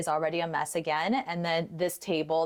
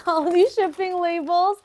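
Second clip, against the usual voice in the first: laughing; 11 semitones higher, 8 dB louder than usual. Real, not acted. Laughing at the pile of labels.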